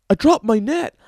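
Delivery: loud, high mock complaint; acted yell as the friend; yelling back. Friend yells back in retold scene.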